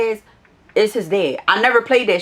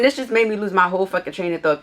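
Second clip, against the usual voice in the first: usual speaking voice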